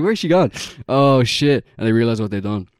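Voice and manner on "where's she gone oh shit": higher, louder panicked cry, laughing through it; hammed-up alarm, breaking into laughter; mock panic. Kids realizing their sister is missing, in laughing mock panic.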